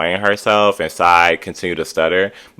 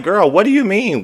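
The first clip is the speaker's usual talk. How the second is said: disbelieving, pitched way up; exasperated; 13 semitones higher than usual. Real, not acted. Recapping a show, reacting to Sai's screaming in disbelief.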